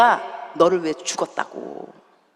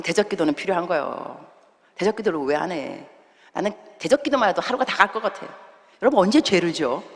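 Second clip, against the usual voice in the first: ordinary delivery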